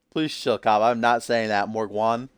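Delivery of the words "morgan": "Morgwan" said MORG-WAHN, with a W; altered pronunciation. Shown as a demonstration. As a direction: put a W sound into "Morgwan", ending in "wan". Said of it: "put a W in there".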